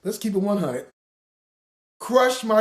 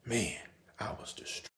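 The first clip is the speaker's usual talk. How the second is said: soft hushed whisper; 9 semitones lower, 12 dB quieter than usual. Real, not acted. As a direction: breathe it out quietly. Whispered aside.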